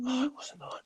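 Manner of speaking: unvoiced whisper to self. Real, not acted. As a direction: breathe it out under the breath. Whispered aside while recalling.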